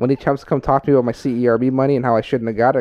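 normal talking voice

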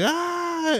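sudden shrill scream, very high. Retold or imitated. Retelling Pee-wee screaming at the convict, high shriek. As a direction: let out a short high shriek. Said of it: "like, screaming".

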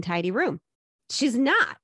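ordinary talk, nothing marked

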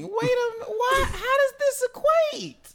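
very high, strained mock disbelief; incredulous outcry. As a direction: squeal it in comic bafflement. Mock disbelief at robber's demand.

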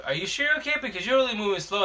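imitated worry, pitched well up; high questioning voice of a concerned neighbour; concerned checking. Neighbours notice the speaker slowing down, imitated high concern.

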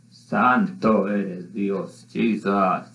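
low, slow murmured prayer aside; murmured praise. Real, not acted. Preacher turns aside mid-sermon to praise God, a low slow murmur.

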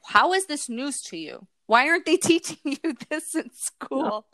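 mildly exasperated, laughing as you ask; retold disbelief. Mother retelling her surprise at what schools skip, incredulous questioning.

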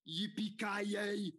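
slow, loud Austrian catchphrase; high, drawn-out Arnold bellow; Arnold accent. Pretend. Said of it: "can you do Arnold if he was John McClane".

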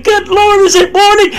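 high, strained mock complaint at waking; groggy morning groan. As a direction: whine it like a sleepy believer dragged out of bed. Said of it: "instead of saying".

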